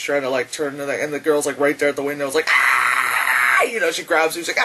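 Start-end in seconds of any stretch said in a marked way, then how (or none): 2.5-3.6 long loud mock scream; drawn-out acted screech; imitated scream.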